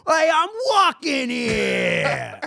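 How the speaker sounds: loud, high New York holler; indignant shout at traffic; cut-off pedestrian's yell. Acted. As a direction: yell it with the last word stretched out. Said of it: "as they get cut off".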